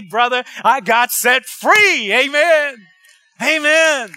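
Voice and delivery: slow, loud, triumphant proclamation; exultant shout of freedom, drawn out; preaching climax. Real, not acted. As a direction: lean into it like a preacher hitting the peak.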